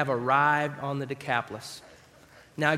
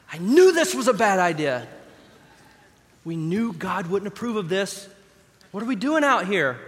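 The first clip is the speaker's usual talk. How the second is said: louder, alarmed outburst in the storm; panicked disciples; 3 semitones higher, 5 dB louder than usual. Acted. Disciples in a sinking boat, panicked cries. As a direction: shout it over the waves, scared.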